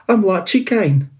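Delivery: a meek clerk begging pardon; apologetic Bob. Acted. Bob answering Scrooge.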